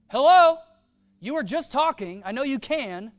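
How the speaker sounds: loud, high nagging call; mock pestering hello. Pharisees nagging Jesus for an answer, loud and high. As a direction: pester him like an impatient crowd.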